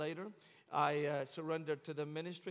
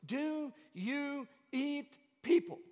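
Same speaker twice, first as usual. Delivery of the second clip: high, slow, puzzled big-guy voice; mock-innocent question from a tall American friend; imitating Tommy; 9 semitones higher, 5 dB louder, much slower than usual. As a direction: ask it slowly, pitched up like a gentle giant. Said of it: "Tommy goes".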